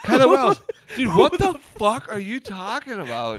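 exasperated shout. Real, not acted. Exasperated calling out.

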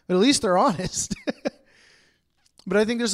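amused laughing aside. Real, not acted. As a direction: chuckle while saying it. Laughing remark.

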